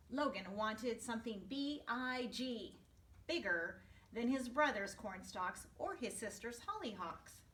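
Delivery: ordinary delivery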